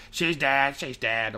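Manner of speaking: mild mock scream, loud then easing; half-hearted imitation of hysterical wailing; imitated screaming. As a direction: retell a panicked partner's cry. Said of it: "she's fucking running around screaming".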